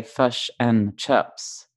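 "fish and chips" in a Kiwi accent, the i pulled back toward "fush and chups"; New Zealand fish and chips. Shown as a demonstration. Australian mimicking Kiwi vowels for her cohost: mock Kiwi "fish and chips". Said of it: "you would say".

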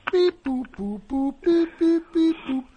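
high robotic beep boop noises. Pretend. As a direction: chirp like a computer. Imitating computer noises.

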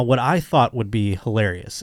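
usual speaking voice

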